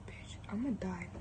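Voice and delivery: hushed dread, almost giggling; scared whisper to the camera; panicked whisper. Real, not acted. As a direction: whisper it in mock doom. Panicking mid-haircut.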